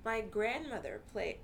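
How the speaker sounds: ordinary delivery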